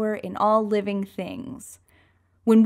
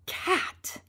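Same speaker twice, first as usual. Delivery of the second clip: breathy whisper; 9 semitones higher, 6 dB quieter than usual. Acted. Climax of transformation.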